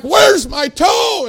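loud, high, drawn-out holler; panicked yell. Retold or imitated. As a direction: shout it in acted panic. Retells panicked yelling before sedation.

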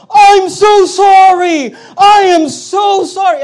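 high, loud, slow over-the-top apology; a cop frantically backpedaling; mock panicked apology. Cop apologizes on learning mistake.

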